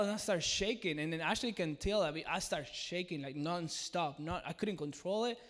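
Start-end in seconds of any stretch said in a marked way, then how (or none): none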